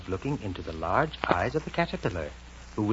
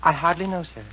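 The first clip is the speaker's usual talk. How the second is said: lightly voiced shy girl, pitched higher; a touch of timid politeness, higher; shy Alice; 8 semitones higher, 3 dB louder than usual. A narrator's reading. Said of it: "Alice answered rather shyly".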